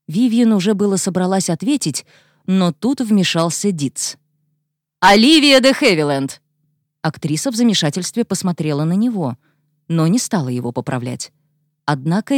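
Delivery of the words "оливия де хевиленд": loud, high call of a name; loud announcement. Acted. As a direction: cut in and announce it loudly. Dietz cuts in, announcing loudly.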